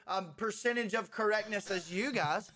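normal talking voice

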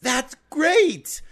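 very high excited cheer; thrilled kid squeal. Pretend. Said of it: "sent us a recording".

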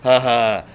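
a loud canned laugh; mock the audience's forced ha ha; fake laugh. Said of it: "they go like".

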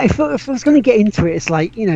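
normal talking voice

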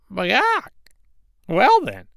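high, loud hen clucking. Acted. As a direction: squawk like a fed-up hen. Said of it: "said the little red hen".

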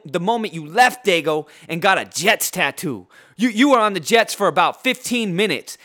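mocking insult rant at a rival; heated rant. Real, not acted. Ranting insults at LT.

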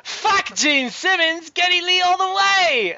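high, slow, hammed-up British listener; cheering in a silly high British voice; mock British accent. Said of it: "I don't know why he's British".

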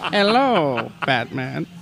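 villain voice. Pretend. Villain greeting in put-on voice.

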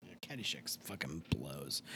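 hushed whispered verdict. Real, not acted. To his co-host on Caddyshack, a whispered jab. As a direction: breathe it out, no voice.

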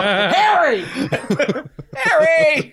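high laughing call. Retold or imitated. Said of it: "God would go".

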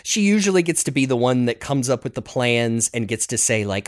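ordinary talk, nothing marked

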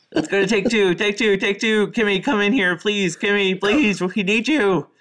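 high, loud call across the room. Real, not acted. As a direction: call her in from afar. Calling Kimmy into the room.

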